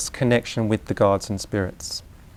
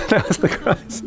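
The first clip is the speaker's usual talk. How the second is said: laughing; 9 semitones higher than usual. Real, not acted. Laughing at finally seeing the crisis.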